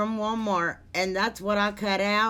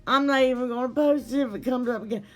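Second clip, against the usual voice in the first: exasperated; 4 semitones higher, faster than usual. Real, not acted. Exasperated laughing rush.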